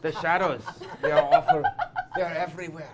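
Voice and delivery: hammed-up terror, high and loud; mock dread. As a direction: play up the horror, laughing.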